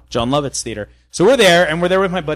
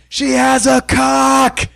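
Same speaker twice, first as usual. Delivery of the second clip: drawn-out, higher shouted blurt; a heckler's slow, raised blurt; mock shout; 8 semitones higher, much slower than usual. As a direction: yell it out slowly across the room. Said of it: "And I'm like".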